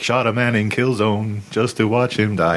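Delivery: slow, squeaky little [name]; sing-talk it like a tiny [name]; miniature [name]. Retold or imitated. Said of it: "have a little squeaky voice and be like".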